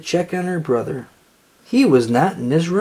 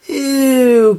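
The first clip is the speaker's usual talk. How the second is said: much higher, louder yelp of disgust; disgusted cry; 9 semitones higher, 6 dB louder than usual. A narrator's reading. Lucy pulling a beagle from the barrel, disgusted cry. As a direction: recoil with a loud ew. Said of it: "cried Lucy".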